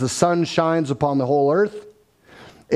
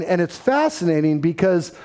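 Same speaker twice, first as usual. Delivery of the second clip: ordinary delivery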